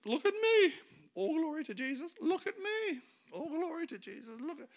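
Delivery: high, loud, preening self-promoter voice. Retold or imitated. Preacher mocks insecure Christians showing off, high and loud. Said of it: "insecure Christians are looking for a platform".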